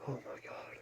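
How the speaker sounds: breathy whisper, no voice. Real, not acted. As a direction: whisper so it won't fly off. Creeping near the bird.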